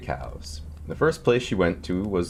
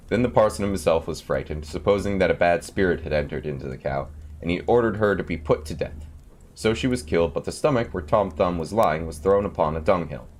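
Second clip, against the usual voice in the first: ordinary delivery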